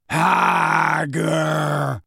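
slow, drawn-out monster call; giant's call. A narrator's reading. Grawp the giant searching, long drawn-out call. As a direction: stretch both syllables as a lumbering giant. Giant calls out one word.

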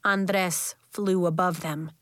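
plain reading voice

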